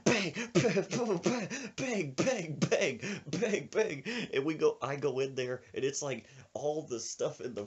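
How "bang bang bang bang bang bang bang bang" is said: rapid higher-pitched banging noises; spoken sound effect, punchy repeated bangs; imitated banging. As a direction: act out kitchen clatter with the voice. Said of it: "we hear all this banging".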